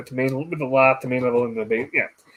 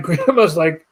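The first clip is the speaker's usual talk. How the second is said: normal talking voice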